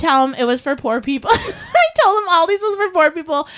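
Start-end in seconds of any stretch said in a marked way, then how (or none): 1.3-3.5 laughing through the words, much higher. Real, not acted. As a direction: giggle while confessing it. Laughing at own story.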